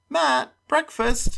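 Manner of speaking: high, slow sing-out call; mother calling. A narrator's reading. Mother summoning Matt to breakfast, calling. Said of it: "my mother calling me".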